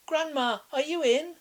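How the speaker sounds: put-on high, bright child calling; little girl voice. A narrator's reading. Girl at grandma's door, high child's call.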